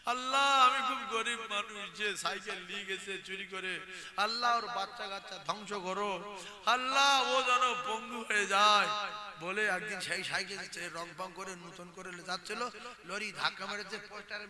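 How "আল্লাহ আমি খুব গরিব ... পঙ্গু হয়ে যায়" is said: slow, understated wailing prayer to Allah; mildly acted weeping victim begging for justice; imitated tearful lament. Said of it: "ও বিরাট কান্না কাঁদছে".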